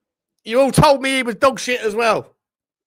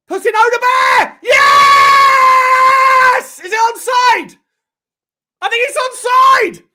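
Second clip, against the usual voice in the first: real, much higher and louder yelling; goal celebration shout; 12 semitones higher, 10 dB louder, much slower than usual. Real, not acted. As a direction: scream it as your team scores. Fan celebrating his team's goal.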